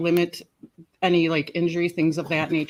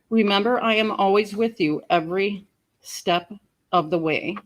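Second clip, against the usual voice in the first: reading a memorial poem aloud, loud and emotional; 3 semitones higher, 4 dB louder than usual. Real, not acted. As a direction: read it to the lost ones, full voice. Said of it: "I just would like to read the following".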